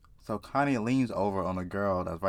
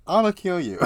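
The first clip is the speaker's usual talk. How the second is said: high, loud threat breaking into laughter; playful high-pitched laughing threat; joking threat; 9 semitones higher, 8 dB louder than usual. Pretend. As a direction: crack up while making a mock threat.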